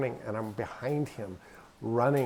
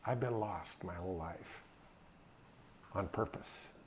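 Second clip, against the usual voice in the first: soft confiding aside; 5 semitones lower, 13 dB quieter than usual. Real, not acted.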